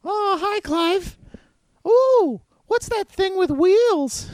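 very high, squeaky alien; chirpy little alien introducing itself; Stitch voice. Acted. Six-armed blue creature greets the party, squeaky and high.

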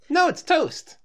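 high-pitched little-sister protest; indignant little sister. Acted. Little sister defending her breakfast to Rarity, high indignant protest. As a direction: correct her in a high kid's voice.

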